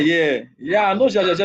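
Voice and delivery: ordinary talk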